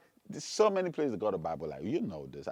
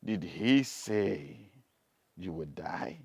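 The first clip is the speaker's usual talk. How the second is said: slow, lightly voiced tempter; 7 semitones lower, much slower than usual. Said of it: "The devil only showed up and said".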